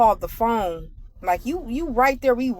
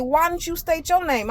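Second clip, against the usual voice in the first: sassy comeback; 8 semitones higher than usual. Pretend. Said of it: "And I'm just like".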